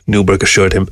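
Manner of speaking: usual speaking voice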